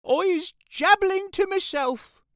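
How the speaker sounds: very high, loud giant's voice; nervous squeal of a cornered giant; BFG. A narrator's reading. Nervous giant answering the towering creature, high-pitched.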